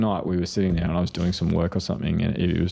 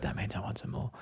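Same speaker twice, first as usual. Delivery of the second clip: put-on soft child voice; 2 semitones higher, 12 dB quieter than usual. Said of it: "she'd be like".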